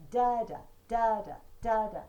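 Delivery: da da chanted loud, first da strong; loud da da chant marking the trochee; trochaic beat. Shown as a demonstration. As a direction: drum out STRONG-weak. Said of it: "use William Blake as your guide".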